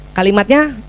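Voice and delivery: normal talking voice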